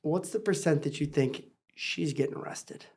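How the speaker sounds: ordinary talk, nothing marked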